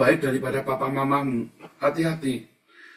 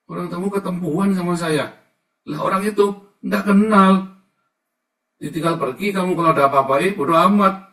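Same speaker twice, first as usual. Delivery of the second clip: loud, much higher, fiery sermon delivery; preaching at full force; 7 semitones higher, 9 dB louder than usual. Acted. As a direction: warn the flock with fire. (khotbah lantang penuh semangat)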